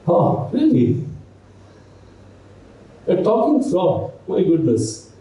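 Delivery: high, surprised "Oh, really?"; student meeting a talking frog, high and surprised; mock amazement.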